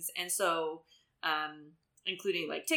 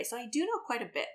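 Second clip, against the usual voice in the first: ordinary talk, nothing marked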